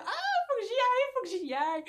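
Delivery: blurting out that she has to go in right now; excited rush. Retold or imitated. Telling her friend about the wool shop, high and hurried excitement.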